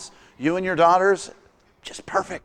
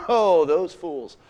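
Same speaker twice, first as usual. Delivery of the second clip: smug scorn; 2 semitones lower, much slower than usual. Retold or imitated. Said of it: "'Cause I go".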